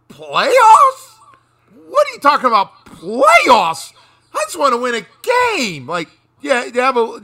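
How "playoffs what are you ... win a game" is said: high, loud incredulous Playoffs?; Jim Mora impression. Friend mocking playoff talk, high and incredulous. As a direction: squeal it in disbelief, louder. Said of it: "somebody in your group's always gonna go".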